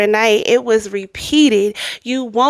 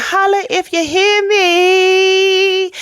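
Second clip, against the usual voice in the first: hype call; 8 semitones higher, slower than usual. Real, not acted.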